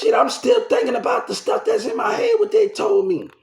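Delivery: high, strained shout; shouting in distress. Real, not acted. Upset man shouts about the memories stuck in his head. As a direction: yell it out, overwhelmed. Traumatized outburst.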